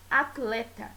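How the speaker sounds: "atleta" said as at-leta; "atleta" with t cut off from l, at-leta; t and l separated. Shown as a demonstration. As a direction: close the first syllable of "atleta" on t. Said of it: "pronunciar de uma maneira um pouco peculiar".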